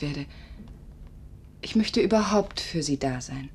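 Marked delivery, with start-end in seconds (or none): none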